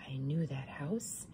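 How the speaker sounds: soft, slow scheming; hint at the plan; sly whisper. A narrator's reading. Wolf hatching a plan, hushed and slow.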